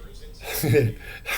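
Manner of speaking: real, breathy little chuckle; excited chuckle. Real, not acted. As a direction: laugh softly at your own excitement. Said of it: "I get so excited".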